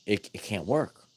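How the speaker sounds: ordinary delivery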